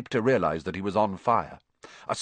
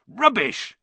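dismissive Hagrid; 12 semitones higher, 3 dB louder than usual. A narrator's reading. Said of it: "said Hagrid again".

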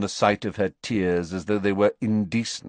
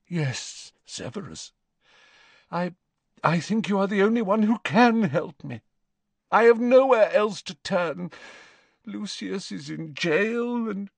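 higher, pleading Narcissa on the edge of tears; desperate Narcissa; 11 semitones higher than usual. Acted. Narcissa begs Snape for help, higher and desperate. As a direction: beg him, voice cracking with despair.

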